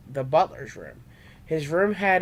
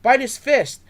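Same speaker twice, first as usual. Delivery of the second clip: much higher, louder battle command; yelled; 8 semitones higher, 5 dB louder than usual. A narrator's reading. Trey commanding Charmander to bite, loud yell. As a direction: bark the order hard. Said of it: "Trey yelled".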